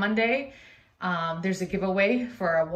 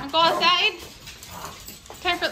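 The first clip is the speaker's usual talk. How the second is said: higher, sing-song pet voice; 11 semitones higher than usual. Real, not acted. Owner asking her dog about going outside, in a higher, coaxing pet voice. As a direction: coax him with a bright lilt.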